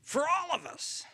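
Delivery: high, loud, delighted; excited call. Pretend. Disciple feeding the crowd, gleeful call. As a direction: announce it with glee.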